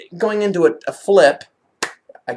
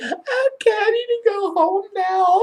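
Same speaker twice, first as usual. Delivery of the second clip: high-pitched mock plea; retold whine. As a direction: squeak it out through laughter. Acting out her own plea, laughing.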